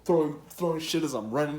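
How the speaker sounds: running from the kitchen staff, hyped up; excited. Retold or imitated. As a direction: tell it like an action movie. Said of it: "this is like a movie".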